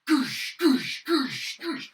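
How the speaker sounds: high loud goosh sounds, mimicked stomps; footstep noises. Troll charging after the fleeing player, stomping footstep noises. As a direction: voice the troll's thudding steps.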